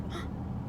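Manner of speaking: sharp gasp at a near miss; startled gasp. Real, not acted. Driving and nearly hit, a sharp startled gasp. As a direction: suck in breath, startled. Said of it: "ooh, that almost got hit".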